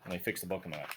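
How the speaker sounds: ordinary delivery, nothing marked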